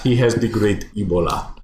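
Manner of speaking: hammed-up African accent. Joking as the homeless wingman, African accent. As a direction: put on a mock African accent. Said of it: "now I remember the accent of this part".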